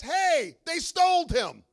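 high, loud alarmed "Hey, they stole him"; mock alarmed cry. Preacher acting Mary, alarmed and loud. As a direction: cry it out like a panicked disciple.

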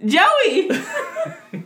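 loud, high, laughing shout; gleeful yell through laughter; calling out. Real, not acted. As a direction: blurt the name out triumphantly. Calls out remembered name, laughing.

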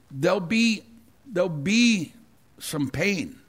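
normal talking voice

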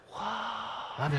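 hushed mock wonder; mock amazement. Norma reacts to the stuffed-dog joke, mock amazement.

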